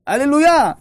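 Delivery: loud, high hallelujah; hurricane's cry. Acted. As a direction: shout praise as the hurricane. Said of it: "מה הם צועקים?".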